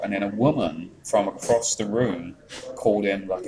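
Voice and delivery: ordinary talk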